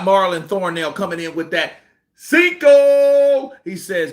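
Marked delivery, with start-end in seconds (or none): 2.1-3.5 high, loud hype shout; excited callout. Real, not acted. Vlogger hyping a viewer's superchat, loud excited call.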